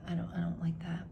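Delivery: fast whispered "I don't, I don't like that"; muttering her dislike to herself; whispered repeat. Real, not acted. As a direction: breathe the repeat quickly under your breath.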